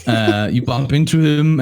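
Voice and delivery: usual speaking voice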